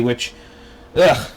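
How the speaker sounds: usual speaking voice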